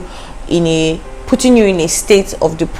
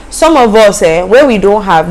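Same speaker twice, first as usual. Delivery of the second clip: ordinary talk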